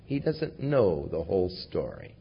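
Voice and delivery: normal talking voice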